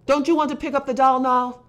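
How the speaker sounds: loud, high, insistent question; angry demand. A narrator's reading. Father questioning Conrad between lashes, angry demand.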